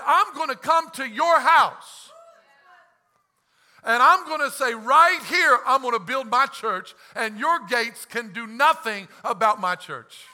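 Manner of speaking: loud defiant declaration; Jesus defying the devil loudly; bold proclamation. Retold or imitated. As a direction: call out the devil to his face. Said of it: "Jesus said".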